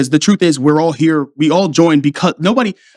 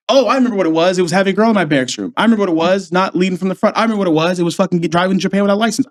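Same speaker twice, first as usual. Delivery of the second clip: ordinary talk, nothing marked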